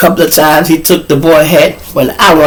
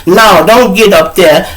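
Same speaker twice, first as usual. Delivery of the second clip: lightly voiced teacher, higher and louder; teacher forbidding; 5 semitones higher, 5 dB louder than usual. Quoting teacher forbidding him.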